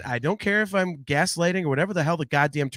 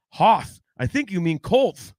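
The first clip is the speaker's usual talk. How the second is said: quoting Family Guy; 3 semitones higher, 4 dB louder, slower than usual. Retold or imitated. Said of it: "quoting Family Guy".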